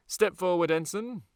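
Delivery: loud, high command; officer summoning a man from the ranks; sharp order. Acted. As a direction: bark the order to step out.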